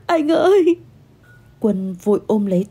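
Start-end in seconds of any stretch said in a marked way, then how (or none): 0.1-0.8 high-pitched sobbing call; bursting into sobs. Acted. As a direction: break down crying as you call to him. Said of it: "tôi òa lên khóc nức nở".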